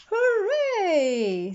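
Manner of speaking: high, loud cheer; children cheering for the lion. Acted. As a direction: shout hooray like happy kids. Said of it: "said the children".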